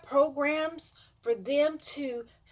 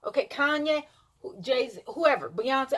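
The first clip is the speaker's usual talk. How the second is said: normal talking voice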